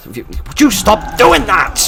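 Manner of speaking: a real fed-up yell at an enemy; exasperated yell. Real, not acted. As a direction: shout it at the pest in the game. Enemy attacks again.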